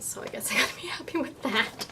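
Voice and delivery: soft disappointed whisper; resigned whisper. Real, not acted. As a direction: whisper it, making do.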